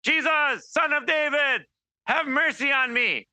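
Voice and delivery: a beggar calling out to Jesus from the roadside, pleading cry. A narrator's reading. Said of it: "he began to cry out".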